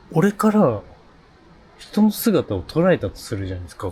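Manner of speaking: ordinary talk, nothing marked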